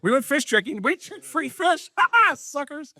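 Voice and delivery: high-pitched, hammed-up taunt; mock gloating. Fisherman jeers at tricked fish, mock gloating. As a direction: crow in a high, silly voice with a laugh.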